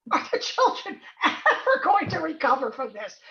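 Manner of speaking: laughing parody of the alarmist question; mock the doom-laden headline; scoffing, laughing repeat of the news worry; mocking quote. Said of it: "We're already seeing stuff on the news".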